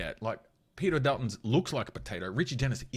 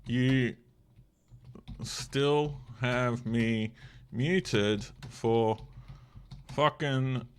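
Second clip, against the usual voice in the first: very slow, word by word, louder; drawn-out call; 4 dB louder, much slower than usual. Real, not acted. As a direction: stretch each word out to tease. Calling out to muted friend.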